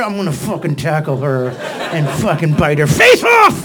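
mock fury building to a shout; overflowing affection as comic rage; angry love. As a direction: start low, erupt at the end. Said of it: "angry, love".

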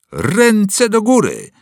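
loud, slow, ruthless order; roared command. Acted. Boy ambushing Winston, roaring a hands-up order. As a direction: bellow it like a hold-up. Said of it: "ryknął bezlitosny głos".

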